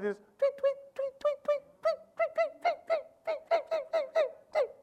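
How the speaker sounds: high chirpy tweet tweet, a bird call done by mouth. Shown as a demonstration. Preacher imitating his morning bird outside the window, chirping high. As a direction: whistle it out like a songbird at dawn. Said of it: "That's my rendition of a bird".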